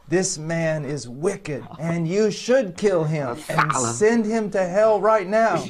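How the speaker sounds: loud, slow, rising pronouncement; forceful imprecatory prayer. Retold or imitated. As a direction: call down judgment at full volume. Retold prayer calling down judgment.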